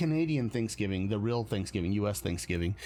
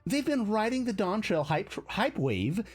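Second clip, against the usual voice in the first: put-on high bubbly valley girl; 9 semitones higher than usual. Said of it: "in my Valley Girl voice".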